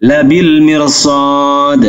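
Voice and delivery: slow, melodic tajwid recitation; Quran recitation. Shown as a demonstration. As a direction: chant the verse slowly like tilawah. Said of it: "recites verse". (bacaan tartil yang lambat)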